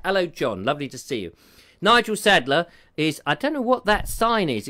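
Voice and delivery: normal talking voice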